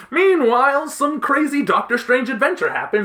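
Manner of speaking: high, loud, over-excited announcement; dramatic scene switch. Shown as a demonstration. Recapping a comic's side plot, loud excited interruption. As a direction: burst in like a hammy narrator.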